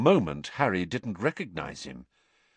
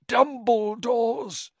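strained effort; 13 semitones higher, much slower than usual. Acted. Crouch straining.

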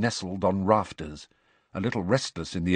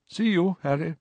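higher, bright goodbye; cheerful farewell; 9 semitones higher than usual. A narrator's reading. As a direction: wave him off brightly.

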